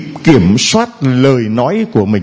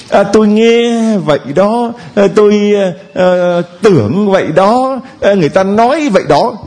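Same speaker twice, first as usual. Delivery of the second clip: higher-pitched mock hedging, with filler sounds; nhại người úp mở; 6 semitones higher than usual. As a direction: imitate someone dodging behind hearsay. (nhại giọng ấp úng, cao hơn)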